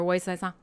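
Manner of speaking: "Ouais, c'est ça" slurred into one phrase; words drawn together. Shown as a demonstration. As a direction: run "Ouais, c'est ça" together without breaks. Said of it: "You just kinda draw it all together".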